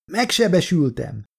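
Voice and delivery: high, half-projected call; shouting back. A narrator's reading. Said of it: "kiáltott vissza".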